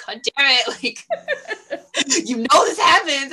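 exasperated mom cracking up; laughing exasperation. Retold or imitated.

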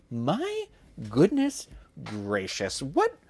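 real disbelief at the gore; taken aback by her own story; shocked. Real, not acted. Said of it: "reacts to gory passage".

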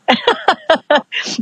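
high, rapid giggle; real tickled laugh; amused laugh. Real, not acted. Amused at own accent slip.

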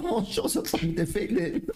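quoting himself while laughing; laughing mock reply. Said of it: "Eu falo".

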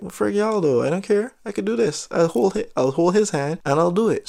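high-pitched stubborn retort; mock defiance. Nervous diver insisting on holding instructor's hand, mock defiance. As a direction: retell digging in, voice lifted. Defiant reply to mermaid swimmers.

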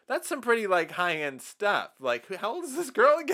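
high, loud incredulous voice; incredulous brother. Retold or imitated. Older brother questioning Connor, high incredulous voice. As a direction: squeak out the disbelief. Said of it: "And he's like".

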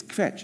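kvetch in one syllable, kv cluster together. Shown as a demonstration. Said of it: "It's one syllable".

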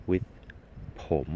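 ordinary delivery

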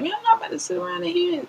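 high defiant complaint; lightly voiced defiant guy. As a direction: sound like a fed-up stranger. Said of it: "people that's like".

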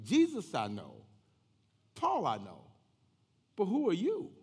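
loud, challenging spirit's reply; evil spirit. Acted. Evil spirit answering the exorcists, challenging. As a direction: punch each phrase, rising to a high question. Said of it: "the evil spirit answered to them".

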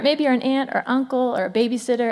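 ordinary delivery

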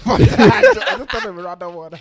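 laughing. Real, not acted. Laughing at own random pick.

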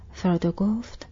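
ordinary delivery

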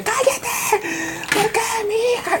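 mock sobbing father; grieving husband. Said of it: "el marido". (padre llorando, voz muy aguda)

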